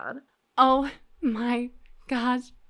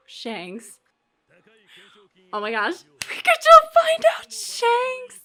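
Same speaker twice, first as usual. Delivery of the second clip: ordinary talk, nothing marked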